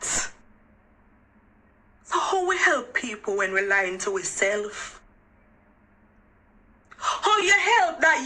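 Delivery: a raw, weeping appeal to women; crying out. Real, not acted. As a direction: voice high and tearful, pushing through sobs. Anguished plea about lying to girls.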